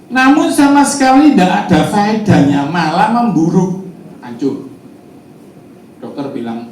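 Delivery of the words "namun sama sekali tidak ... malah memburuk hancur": loud, high-pitched proclamation of the verse; scripture reading aloud. Real, not acted. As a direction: ring the verse out to the congregation. Pengkhotbah membacakan ayat Alkitab. (membaca ayat dengan suara tinggi dan lantang)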